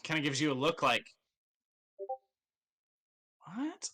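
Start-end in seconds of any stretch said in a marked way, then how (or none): 3.4-3.9 high, soft puzzled "What?"; a baffled squeak of a question. Acted. Merchant gives a puzzled look.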